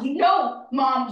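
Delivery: loud, cutting jab at mom; loud accusation. Acted. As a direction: spit the word mom out in anger. Daughter accuses mother at memorial.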